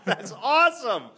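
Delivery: cheering through laughter; excited laughing cheer. Real, not acted. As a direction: whoop it, laughing and thrilled. Host cheers the imagined shot.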